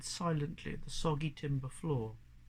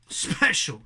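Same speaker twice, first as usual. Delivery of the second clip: spluttering scorn; 10 dB louder than usual. Acted. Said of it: "she spluttered".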